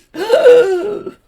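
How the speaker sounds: loud high gasp; shocked gasp. Retold or imitated. Flora gasps on seeing the blue dress. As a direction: gasp in outrage.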